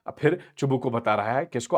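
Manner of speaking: ordinary delivery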